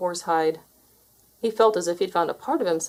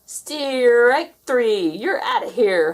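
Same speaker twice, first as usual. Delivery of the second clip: high, loud howled call; umpire howling; 5 semitones higher, 4 dB louder, much slower than usual. A narrator's reading. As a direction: howl the out like a triumphant umpire. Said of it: "howled the umpire".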